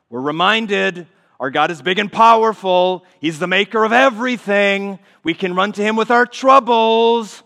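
swelling pulpit declaration; full-force preaching. Acted. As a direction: preach it out with fire. Preaching builds.

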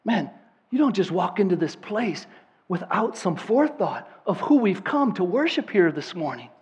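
usual speaking voice

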